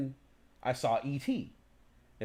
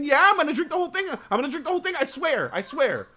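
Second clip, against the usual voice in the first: put-on much higher and louder, fast begging child; squealing little kid swearing he'll finish the whole thing; eager kid; 13 semitones higher, 6 dB louder, faster than usual. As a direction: promise it like a kid desperate for a treat. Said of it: "Like the kid inside of you".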